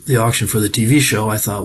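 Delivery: ordinary talk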